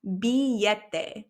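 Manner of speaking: Billete said bee-YEH-teh, double L as Y; Billete, slow with a Y sound; Spanish pronunciation. Shown as a demonstration. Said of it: "Let's say it together".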